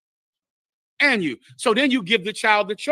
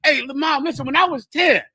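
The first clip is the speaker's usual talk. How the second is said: lightly voiced child, higher pitch; child confronting a parent; 6 semitones higher than usual. Adult child to mom about age ten, tentative confiding. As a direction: raise the pitch, open a heart-to-heart.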